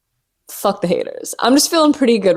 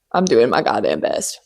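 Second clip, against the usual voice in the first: much lower, fed-up mutter; exasperated grumble; 9 semitones lower than usual. Acted. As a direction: let the frustration sink low. Exasperated drop after cheerful line.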